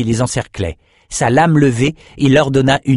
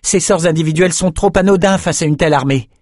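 put-on higher girlish voice, urgent; Ambre's voice; 8 semitones higher than usual. A narrator's reading. Ambre arriving to urge her friends, higher urgent voice. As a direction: run in and urge a better plan. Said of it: "dit Ambre".

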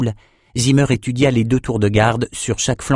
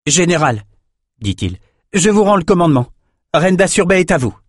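put-on young officer reporting; 8 semitones higher, 3 dB louder than usual. A narrator's reading. Soldier formally handing over command.

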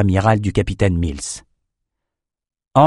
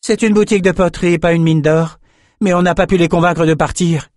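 raised pitch, exasperated complaint; upset, annoyed; 8 semitones higher than usual. A narrator's reading. Complaining that the potters would not leave, annoyed. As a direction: protest with the voice lifted. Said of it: "Lila semblait elle aussi très contrariée".

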